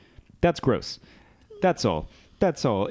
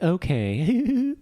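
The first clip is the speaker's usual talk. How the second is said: high, drawn-out swooning okay; dreamy yes; 8 semitones higher than usual. Retold or imitated. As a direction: sigh it like a smitten girl. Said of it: "she's like".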